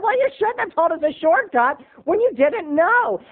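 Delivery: very high scolding; mom. Retold or imitated. Wife scolding husband, very high. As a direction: nag him for the bad shortcut. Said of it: "she said".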